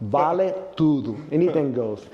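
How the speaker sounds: Vale tudo slowed, with a Brazilian Portuguese lilt; Portuguese accent. Shown as a demonstration. Host trying out the Portuguese phrase for his guest, in a light Portuguese accent. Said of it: "I'm trying to do the Portuguese, uh, accent".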